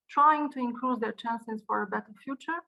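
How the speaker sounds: ordinary delivery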